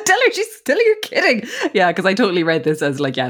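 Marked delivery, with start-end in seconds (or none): 0.1-1.4 very high, pleading imitation; mock panic. Todd pleads with Maddie to take it back, in mock panic. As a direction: beg in a hammed-up squeak. Echoing Todd's frantic denial.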